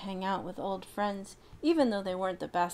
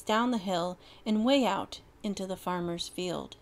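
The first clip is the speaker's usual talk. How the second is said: plain reading voice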